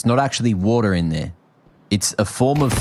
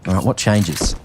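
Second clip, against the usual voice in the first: esports play-by-play; 3 semitones higher than usual. Acted. Continues casting.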